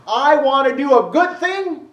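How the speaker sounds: a preacher acting out Paul's frustrated wish. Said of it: "Paul's frustrated".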